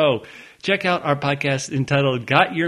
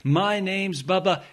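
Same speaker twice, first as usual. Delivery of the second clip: ordinary talk, nothing marked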